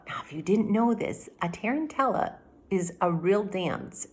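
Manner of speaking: ordinary delivery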